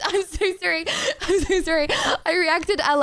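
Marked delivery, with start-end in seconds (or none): none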